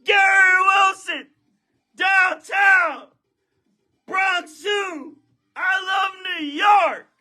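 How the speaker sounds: losing it over the big pull; excited shouting. Real, not acted. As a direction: scream every name like a fan.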